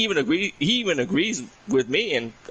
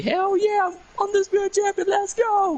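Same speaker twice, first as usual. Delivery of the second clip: much higher, cheering like the new champ; hyped celebration. Retold or imitated. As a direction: shout the win as the champion. Imitating wrestler's title celebration.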